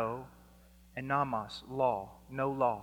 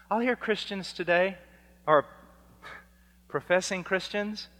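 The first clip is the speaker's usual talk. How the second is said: usual speaking voice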